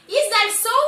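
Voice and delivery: loud high sarcastic is that so; angry retort. Acted. Husband snapping back at his wife, loud sarcasm. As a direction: hurl it back in scorn.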